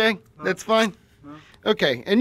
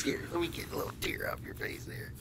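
soft whisper; 15 dB quieter than usual. Acted. Robber wipes hostage's tear.

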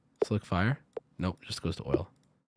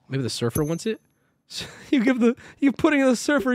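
normal talking voice

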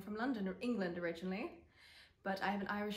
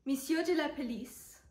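mild sneer on a raised pitch; 4 semitones higher than usual. A narrator's reading. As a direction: read it as a high, mocking jab. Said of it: "Stephen sneered".

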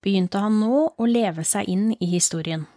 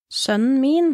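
a higher, louder cry rising on min; anguished cry; 6 semitones higher, 4 dB louder than usual. Acted. Mother cries out for her son as the killer approaches. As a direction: cry out for him in horror.

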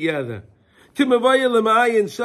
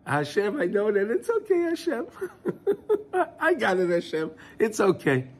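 meek acceptance; 5 dB quieter than usual. Retold or imitated. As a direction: play the gentle pushover, sweetly giving in to Hashem. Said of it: "the other guy's gonna think that you're some wimpy guy".

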